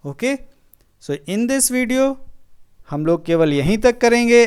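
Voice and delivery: normal talking voice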